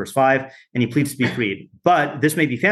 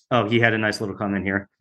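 ordinary talk, nothing marked

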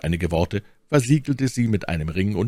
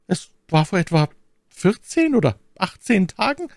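very high, loud maid's voice; aufgeregte Ketty; 12 semitones higher, 4 dB louder than usual. Acted. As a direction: report it anxiously in a high voice. Anxious maid answers question. (aufgeregte, hohe Mädchenstimme)

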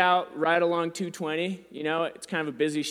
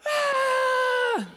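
much higher falsetto shriek imitating the baby; mock baby shriek. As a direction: squeal like a screaming toddler. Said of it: "she's just like".

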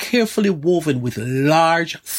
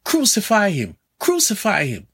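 high-pitched retold crowd cry, falling on each crucify; crowd shouting; 8 semitones higher than usual. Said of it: "the crowds shouting".

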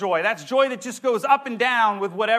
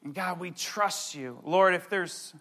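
closing prayer; 5 semitones lower, 4 dB quieter than usual. Acted. Pastor praying over the congregation, soft reverent prayer. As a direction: pray it gently over the room.

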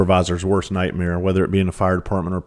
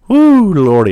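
high, loud woo, Lordy, a real burst; excited whoop; 12 semitones higher, 11 dB louder, slower than usual. Real, not acted. Thinking back on his rookie years, a loud high whoop. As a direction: let out a big amazed whoop.

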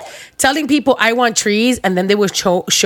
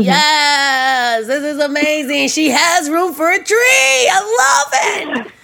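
rising higher and higher, gushing; excited gush; 6 semitones higher, slower than usual. Retold or imitated. Client retelling her thrilled reaction to the designer, gushing higher. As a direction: squeal it out as the pitch climbs to the top.

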